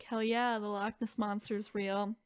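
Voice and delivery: usual speaking voice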